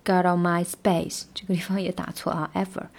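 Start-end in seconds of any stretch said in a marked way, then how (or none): 0.0-1.2 slow, even practice read. Shown as a demonstration. Teacher drills the tricky run slowly. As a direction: spell out each linked word slowly. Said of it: "这个三连要稍微练一下".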